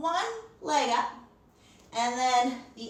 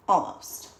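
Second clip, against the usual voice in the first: whispered almost; 5 semitones lower than usual. A narrator's reading. Story punchline pause.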